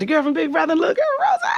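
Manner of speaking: very high, girlish mock naivety. To the executives, very high mock naive chirping.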